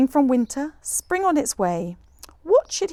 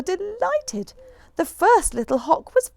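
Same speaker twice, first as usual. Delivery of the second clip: delighted; 10 semitones higher than usual. A narrator's reading. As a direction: stretch it out with delight. High slow delighted reading.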